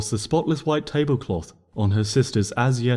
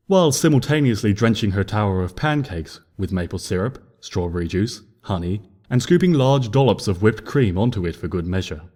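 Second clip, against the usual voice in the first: ordinary delivery, nothing marked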